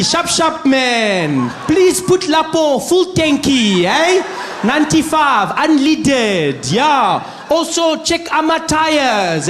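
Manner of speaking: put-on high, loud, slow broken-English accent; mock patronizing accent. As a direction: talk down loudly to the attendant.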